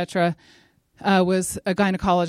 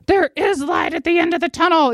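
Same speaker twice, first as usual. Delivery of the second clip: high, forceful mock shouting; imitated preacher's yell; 10 semitones higher, 4 dB louder than usual. Speaker imitating a church elder on a CD, yelling. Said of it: "he's, like, yelling things".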